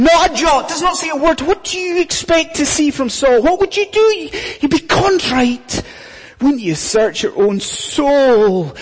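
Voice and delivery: ordinary delivery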